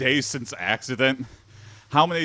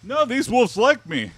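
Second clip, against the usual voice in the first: naive early dog owner; 13 semitones higher, 5 dB louder than usual. Acted.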